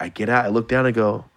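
normal talking voice

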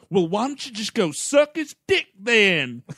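high, put-on Southern voice; hammed-up Southern jibe; mock Southern accent. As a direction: imitate the actress's overdone Southern accent. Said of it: "like, Olivia Williams".

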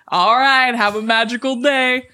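sugary theme-park sign-off; bright mock cast member goodbye; cheery cast member.

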